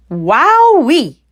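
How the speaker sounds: a cub stunned by fiery cider; wowee. Acted. Cub after strong cider.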